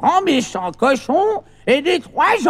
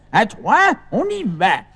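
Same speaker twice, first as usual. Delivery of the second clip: ordinary talk, nothing marked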